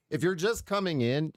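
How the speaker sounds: normal talking voice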